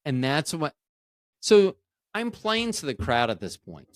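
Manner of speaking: ordinary talk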